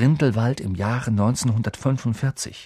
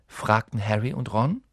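usual speaking voice

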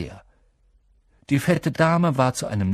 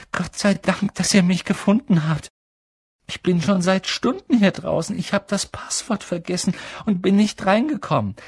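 put-on relieved frightened boy; 9 semitones higher than usual. A narrator's reading. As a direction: babble with relief. Neville relieved to be found.

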